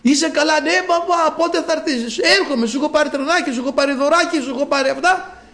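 high call with a laugh. Retold or imitated. Father shouting to his son under the hospital wall, high and laughing. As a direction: call up to the child, half laughing. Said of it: "Να φωνάζει κάτω από τις μάντρες".

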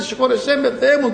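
lightly imitated tearful pleading; high-pitched mock whimper for forgiveness; mock whining. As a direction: whine a little, like a penitent begging. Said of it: "μόλις άρχισε να κλαψουρίζει, να κλαίει, να γκρινιάζει".

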